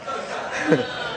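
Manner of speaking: soft airy chuckle; breathy laugh. Real, not acted. Pastor cracking up at his own story, breathy laugh.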